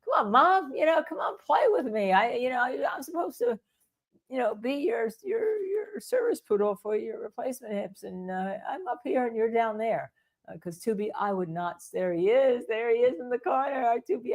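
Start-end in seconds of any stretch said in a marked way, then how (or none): 0.0-10.1 higher pleading puppy voice; playful dog voice asking for attention; voicing the poodle. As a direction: beg mom to play as the poodle. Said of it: "it's like".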